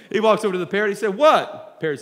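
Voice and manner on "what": loud, high, short what; exasperated retort. Acted. Customer to parrot that insulted him, exasperated retort. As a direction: snap back at the heckler.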